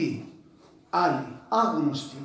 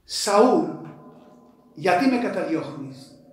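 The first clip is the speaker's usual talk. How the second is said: higher and louder, rising to a cry; pleading call; 4 semitones higher, 7 dB louder than usual. Acted. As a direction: cry out to the persecutor, building. Preacher voices Christ calling Saul.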